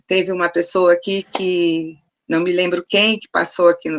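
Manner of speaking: ordinary talk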